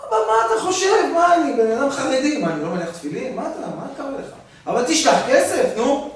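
high, indignant son's voice; hammed-up son protesting, pitched well up. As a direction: play the offended son brushing dad off. Son answers father indignantly.

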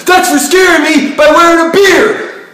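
very loud, high furious yelling; angry yelling. Acted. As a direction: scream it at him in fury.

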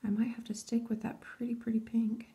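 soft concentrated whisper. Real, not acted. Vlogger whispers to viewers while stamping. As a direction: whisper while you focus on the nail.

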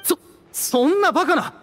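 stammered stunned exclamation; shocked disbelief. Acted. Ogzu hearing Edgar is Kiria, stammering shock. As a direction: recoil in disbelief.

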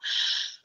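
breath pushed out in a long sigh before speaking; big sighing exhale. Retold or imitated. Wasting breath before speaking, big sigh out. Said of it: "And they'll go".

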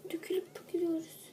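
fast grumbling whisper. Acted. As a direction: mutter it under your breath. Whispered aside. (fısıltı)

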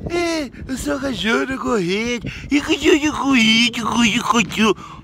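high, mocking voice of a nosy person; mocking people's talk. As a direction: sneer it like a gossiping neighbour. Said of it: "e vai falar assim".

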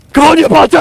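high, loud fighting shout; fierce shout mid-firefight; battle yell. Real, not acted. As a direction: roar at the enemy as you charge. Firefight, yelling at enemy.

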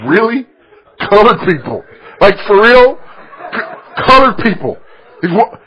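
loud, high, laughing disbelief; repeating "colored people" back in loud amazed disbelief; incredulous disbelief. Retold or imitated. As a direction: laugh at the absurdity in open disbelief. Incredulous retort to a slur.

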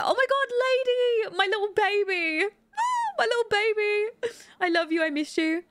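high, wailing fake cry over the baby; mock sobbing. Gamer mock-wailing at her Sim baby, fake crying. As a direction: ham up a tearful goodbye to a baby.

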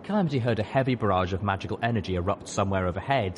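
plain reading voice

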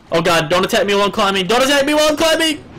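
fast plea climbing to a loud shriek; rising panic. Real, not acted. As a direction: start alarmed, build to high screaming. Attacked while climbing.

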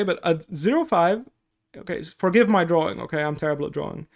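ordinary delivery

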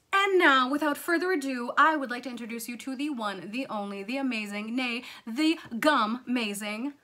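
big showy announcer build-up, loud at the start; grand, playful host intro; hyped introduction. Acted. Introduces the story's heroine.

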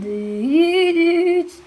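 long, high-pitched "düüüt" noise; imitated hoot. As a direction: hoot out a drawn-out, rising toot. (uzun, tiz düt sesi taklidi)

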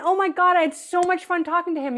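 a hint of an enthusiastic visitor; charmed guest. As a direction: gush a little about the cousin. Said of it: "Or that cousin".

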